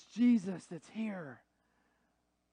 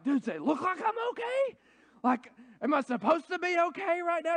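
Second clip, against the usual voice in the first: high-pitched, incredulous retort to a well-meant question; exasperated comeback; 10 semitones higher, 5 dB louder than usual. Acted. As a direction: fire back as if pushed past your limit. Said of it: "you just wanna be like".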